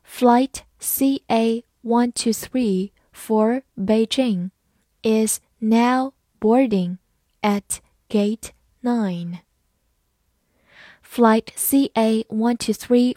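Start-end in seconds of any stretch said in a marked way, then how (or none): 0.1-9.4 slow model reading in short separated chunks. Shown as a demonstration. Teacher reading an airport boarding call for learners, slowly. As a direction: read it slowly, pausing between words. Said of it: "好，慢慢来读".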